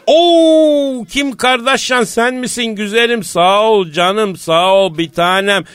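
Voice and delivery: high, drawn-out delighted "oo" easing into slow endearments; gushing phone greeting. Pretend. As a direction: gush over a dear caller, stretching the words. Comic phone greeting to celebrity friend.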